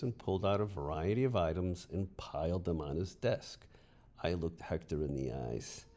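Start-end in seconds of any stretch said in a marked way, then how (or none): none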